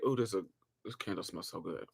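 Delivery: quietly savoring a lovely smell; soft savoring. Real, not acted. Savoring a candle scent.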